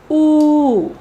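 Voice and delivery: "oo" held long, falling away. Shown as a demonstration. Teacher voicing the phonetic symbol, long U. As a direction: draw "oo" right out. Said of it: "symbol of long U sound".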